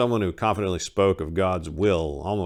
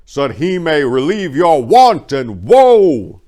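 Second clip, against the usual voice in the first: much higher, much louder royal proclamation, slow on key words; booming king; 8 semitones higher, 12 dB louder than usual. A narrator's reading. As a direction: address the whole city from a high platform. Cyrus addresses the crowd.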